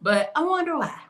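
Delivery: sarcastic. Real, not acted. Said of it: "I'm being real sarcastic".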